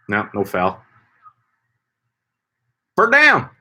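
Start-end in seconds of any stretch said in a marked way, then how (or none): 3.0-3.5 announcer call. Pretend. Mock football announcer call.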